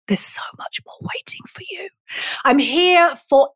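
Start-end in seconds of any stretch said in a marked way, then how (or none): 0.1-1.9 hushed, secretive whisper; confiding whisper. Real, not acted. Coach drawing the listener closer to promise more, whispered.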